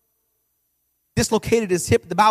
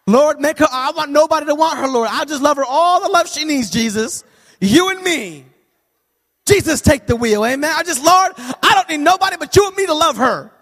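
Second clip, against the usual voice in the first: louder, hammed-up pleading prayer; mock prayer; 5 semitones higher, 5 dB louder than usual. Father praying his daughter stays unloved, mock pleading prayer. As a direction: beg the Lord half-jokingly.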